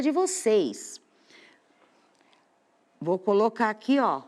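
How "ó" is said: usual speaking voice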